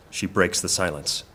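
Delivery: ordinary talk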